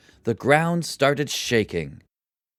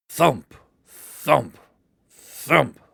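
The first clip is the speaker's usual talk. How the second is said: heavy footsteps; 4 dB louder, much slower than usual. A narrator's reading. Ogre's steps on beanstalk.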